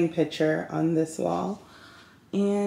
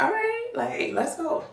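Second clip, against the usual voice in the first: eager agreement. Retold or imitated. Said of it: "So I was like".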